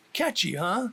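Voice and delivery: ordinary delivery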